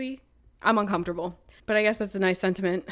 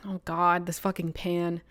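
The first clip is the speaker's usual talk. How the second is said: ordinary talk, nothing marked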